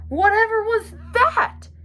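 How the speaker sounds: high, slow, startled badger; high surprised badger voice; startled cry. A narrator's reading. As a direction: jump at the sudden thud. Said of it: "he cried".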